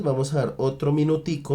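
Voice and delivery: normal talking voice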